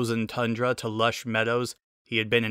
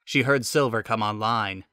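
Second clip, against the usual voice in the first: plain reading voice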